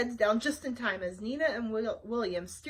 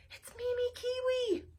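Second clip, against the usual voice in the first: fearful; 13 semitones higher than usual. Acted. Wanda names the villain.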